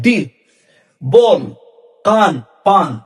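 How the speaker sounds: দিন, বল, কান, পান each ending firmly on its last consonant; closed syllables, final stop. Shown as a demonstration. Teacher listing example words to show closed syllables, each stopped at the end. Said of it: "এই যে থেমে যাচ্ছেন সর্বশেষ শব্দে".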